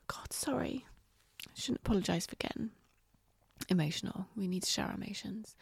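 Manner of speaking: tearful near-whisper, fighting back emotion; holding back tears, words barely voiced; choked up. Real, not acted. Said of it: "God, I feel really emotional today".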